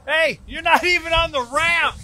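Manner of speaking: loud, high teasing call; excited teasing shout. Real, not acted. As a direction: laugh at him off the ramp. Teasing the driver mid-run.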